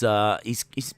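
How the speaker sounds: normal talking voice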